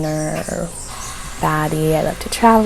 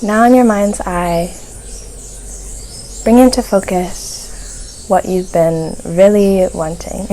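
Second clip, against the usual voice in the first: guided meditation; 5 dB quieter than usual. Real, not acted. Host guiding listeners to soften their focus, guided meditation. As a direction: ease listeners gently into calm, phrase by phrase.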